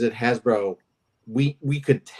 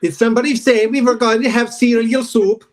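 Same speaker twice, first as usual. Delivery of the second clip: excited call; 7 semitones higher, 7 dB louder than usual. Pretend. Swedes come running joke.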